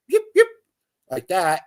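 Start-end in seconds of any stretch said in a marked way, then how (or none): 0.1-0.5 high, sharp yips; coyote yip imitation. Telling friends the coyote answered the owl, high sharp yipping. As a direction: yelp it like a coyote. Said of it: "a coyote yip off to the side".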